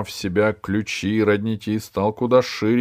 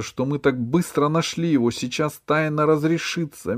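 excited thought; 7 semitones higher than usual. A narrator's reading. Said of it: "подумала Алиса".

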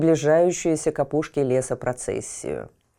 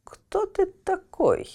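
talking tree; slower than usual. Acted. Old oak asks who he is.

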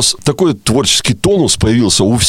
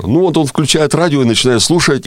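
usual speaking voice

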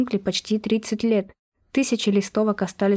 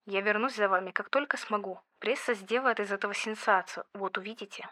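much softer, whispered vow; whispered promise; 11 dB quieter than usual. Acted. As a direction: promise it in a hushed voice. Farewell promise, hushed. (тихое обещание шёпотом)

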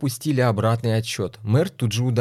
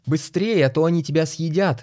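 shouting; 6 semitones higher than usual. Acted. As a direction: scream a warning from the stands. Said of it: "закричала".

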